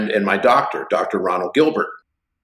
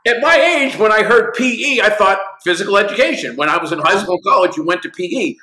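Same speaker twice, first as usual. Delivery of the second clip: imitating the doctor; 8 semitones higher than usual. Retelling the doctor friend's explanation, higher imitating voice. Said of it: "he goes".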